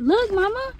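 usual speaking voice

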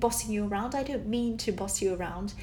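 ordinary talk, nothing marked

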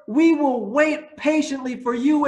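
normal talking voice

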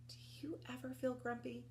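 soft secret whisper; hushed question. Acted. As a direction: whisper it to the children. Teacher hushes to children.

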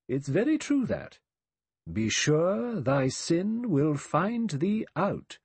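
high, drawn-out scriptural warning; solemn recitation. A narrator's reading. Emily Brent quoting her nursery text to Vera, solemnly. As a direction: intone the text slowly and solemnly. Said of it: "Miss Brent murmured".

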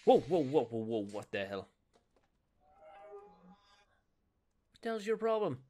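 startled, loud whoas; alarmed shout. Real, not acted. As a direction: yell in alarm at attacker. Attacked in game, alarmed.

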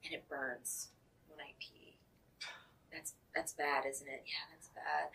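soft, low and subdued; worried admission. Acted. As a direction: admit it quietly, half to yourself.

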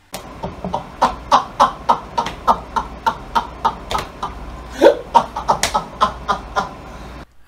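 wheezing laugh; long airy laughing. Real, not acted. Husband wheezing with laughter at the joke.

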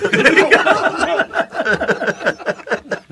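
real burst of high laughter. Real, not acted. Teller laughing at his own joke, high laugh. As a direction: crack up at the punchline.

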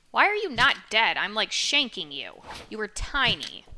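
loud exasperated yell; frustrated shouting. Real, not acted. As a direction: yell in disbelief. Yelling at enemy.

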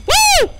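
high, loud whoop; piercing high yelp; startled whoop. Real, not acted. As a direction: let out a sudden shriek. Startled in horror game.

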